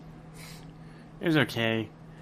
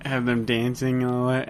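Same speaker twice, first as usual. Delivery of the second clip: ordinary delivery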